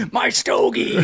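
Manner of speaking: high, put-on baby gangster voice; Baby Herman voice. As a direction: squeak it like a cigar-chomping baby. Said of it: "fucking Baby Herman".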